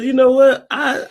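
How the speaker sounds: high-pitched comic quote, loud at the start; mock delighted dude. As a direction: squeal it as a tickled man giving in. Joking impression of pleased dude.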